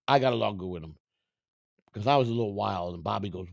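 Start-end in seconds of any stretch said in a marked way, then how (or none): none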